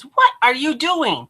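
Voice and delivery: high, incredulous question falling at the end; retold bafflement. Friend baffled by sudden change.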